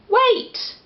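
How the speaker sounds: high, hasty call; high panicky wait; urgent knight's cry. A narrator's reading. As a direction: stop the dragon in a flurry. Knight stalls the dragon.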